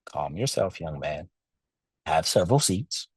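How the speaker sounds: low, soft, unhurried wisdom; low quiet elder telling him to sit down; calm future self. Retold or imitated. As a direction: settle the hothead inner voice. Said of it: "My future self was like".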